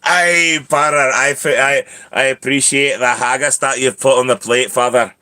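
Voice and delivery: put-on hearty Scottish-accented cat voice; put-on Scottish brogue. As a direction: thank him in a broad Scottish accent. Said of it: "He one hundred sounds Scottish".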